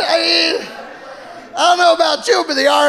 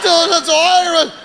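ordinary talk, nothing marked

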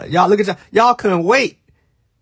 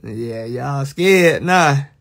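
slow taunt; 3 semitones lower, much slower than usual. Acted. Streamer gloating over scared chat, very slow. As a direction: drag every word out slowly.

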